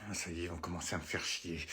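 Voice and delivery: low grumble under the breath; annoyed mutter. Acted. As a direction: mutter it to yourself, fed up. Teacher muttering aside. (marmonné agacé, à voix basse)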